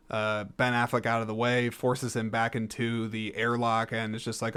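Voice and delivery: ordinary delivery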